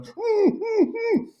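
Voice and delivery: high, tight little "mm" hums; imitating a frazzled contestant. A stressed fellow contestant answers him with high nervous hums. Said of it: "And she was like".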